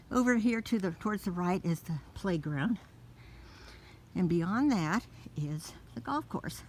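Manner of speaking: normal talking voice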